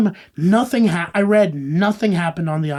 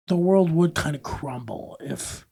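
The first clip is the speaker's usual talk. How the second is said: whispering; 3 semitones lower, 5 dB quieter than usual. Real, not acted. Hushed aside.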